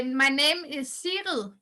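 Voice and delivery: "Cyril" said slowly and clearly for listeners; slow and deliberate so everyone learns "Cyril"; careful name pronunciation. Shown as a demonstration. Asked by the podcast host, says "Cyril" slowly and clearly. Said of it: "could you please say your name".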